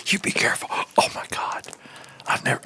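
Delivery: breathy close-mic whisper; ASMR whisper. Pretend. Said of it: "doing my A S M R voice".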